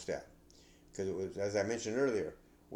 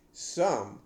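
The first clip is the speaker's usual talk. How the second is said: Some said louder and held, then a pause; stress on SOME; 2 semitones higher, 6 dB louder than usual. Shown as a demonstration. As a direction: punch the word Some. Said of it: "I emphasize the word some".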